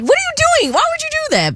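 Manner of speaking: high, disbelieving outcry; incredulous. Retold or imitated. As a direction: pitch way up in mock shock. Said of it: "I was like".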